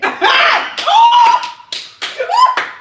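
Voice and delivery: high, loud burst of laughter; loud shrieking laugh. Real, not acted. Friend cracking up at the husband quote, shrieking laugh.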